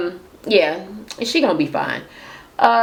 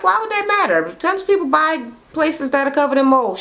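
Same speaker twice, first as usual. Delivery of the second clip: incredulous; 8 semitones higher than usual. Real, not acted. Reacting to Sheree's jab.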